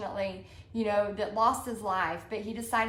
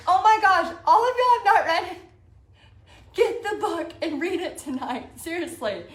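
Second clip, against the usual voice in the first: much higher and louder, gushing disbelief; fired up; 6 semitones higher, 7 dB louder than usual. Real, not acted. Urging viewers to read the book, excited shout. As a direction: burst out, can't believe nobody read it. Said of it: "I get very fired up about this stuff".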